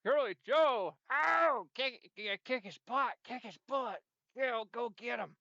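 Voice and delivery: high, loud cheering on the fight; excited cheering. Real, not acted. Cheering Curly Joe in the brawl, excited. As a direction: egg the wrestler on.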